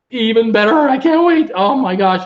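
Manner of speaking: can't contain the excitement; gushing with real delight about new lighting; excited. Real, not acted. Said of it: "I'm just so excited".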